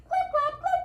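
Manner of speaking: high clip clop sounds; hoof clip clops. Acted. Teacher making middle goat's hoof sounds, high. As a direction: tap out the hooves up high.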